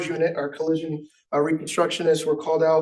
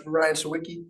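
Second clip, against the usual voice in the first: normal talking voice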